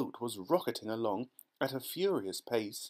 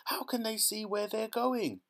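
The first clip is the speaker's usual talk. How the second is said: put-on much higher voice, a frightened girl; shrieking; 11 semitones higher than usual. A narrator's reading. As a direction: shriek it into the dark. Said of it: "shrieked Violet Beauregarde".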